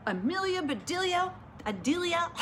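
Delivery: high-pitched calling of names; scolding shout. A narrator's reading. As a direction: cry out the names of the culprits. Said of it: "shouted Missus Rogers".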